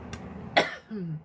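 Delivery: hard cough, wrong pipe; choking cough. Real, not acted. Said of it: "it went down the wrong pipe".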